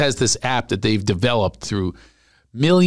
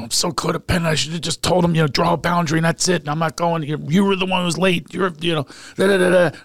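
enraged rant; 7 semitones higher, faster than usual. Retold or imitated. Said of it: "and I was enraged".

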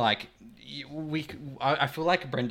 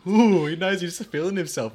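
mock smug ooh; 7 semitones higher, 8 dB louder than usual. Mimicking Ash's smug moment.

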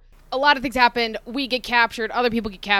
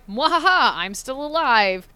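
evil villain laugh; 4 semitones higher, 4 dB louder than usual. Retold or imitated. Villain X-Death returning.

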